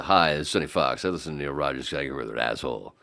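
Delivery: low DJ promo, quick finish; Sonny Fox. Retold or imitated. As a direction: greet like a DJ, then rattle through the testimonial. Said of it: "right after the".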